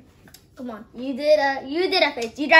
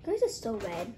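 ordinary talk, nothing marked

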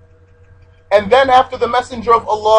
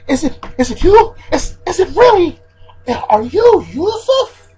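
high, halting, stammered disbelief climbing higher; incredulous amazement; 9 semitones higher than usual. Acted. Teacher acting the brothers recognizing Yusuf, stunned disbelief. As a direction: stumble over the question, then stretch it out high. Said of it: "As if they're saying".

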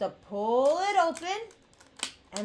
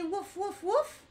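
softer woofs that rise at the end; gentle prompting woofs for kids to copy; dog woof; 5 semitones higher, 4 dB quieter than usual. Shown as a demonstration. Inviting kids to say woof, soft rising woofs. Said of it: "Can you guys say".

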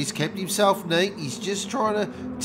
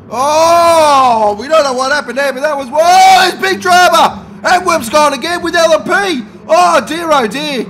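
high, loud cries of big drama; frantic commentator shouting over a crash; excited yelling; 9 semitones higher, 8 dB louder than usual. Acted. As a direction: wail it like a crash just happened.

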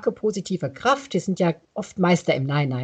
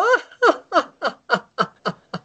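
high laugh; 9 semitones higher than usual. Shown as a demonstration. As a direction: let the laugh burst out. Laughter yoga demo.

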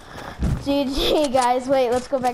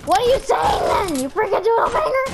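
much higher, louder, incredulous; exasperated yell; 8 semitones higher, 4 dB louder, faster than usual. Real, not acted. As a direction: shout in playful exasperation. Yelling at garbled teammate.

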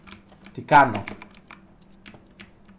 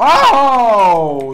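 surprised yell; 11 semitones higher, 17 dB louder than usual. Real, not acted. Sudden gamer exclamation.

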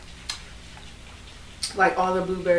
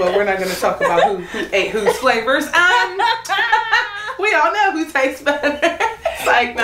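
high, giggling through the words; laughing; 7 semitones higher than usual. Real, not acted. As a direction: tease with giggles. Teasing banter, laughing.

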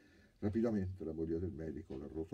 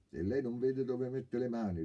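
sharp, louder comeback; curt retort; 8 semitones higher, 7 dB louder than usual. Acted. Girl retorts curtly to the groping thief. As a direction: snap it back at him. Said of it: "rispose seccamente la ragazza".

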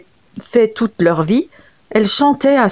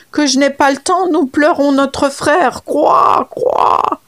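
higher, crying croaks; wailing frog; 6 semitones higher, slower than usual. Acted. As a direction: wail out the grief to stall him. Frog wails at the leopard. (grenouille qui se lamente en criant)

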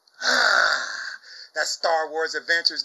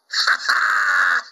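triumphant shouted ha ha; gloating laugh; 10 semitones higher, 6 dB louder than usual. Real, not acted. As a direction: crow it out loud, high and triumphant. Gloating taunt at community.

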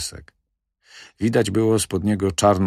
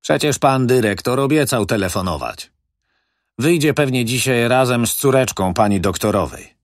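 lightly raised, louder character voice; 6 semitones higher, 4 dB louder than usual. Acted. A hint of the manager reassuring the old doctor's widow. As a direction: narrator lifts into a character's reassurance.

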